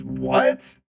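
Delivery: ordinary delivery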